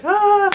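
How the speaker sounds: high, loud happy cry; imitated cheery shriek; cheerful squeal. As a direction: let out a bright, high "ah". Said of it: "cheerful and everything".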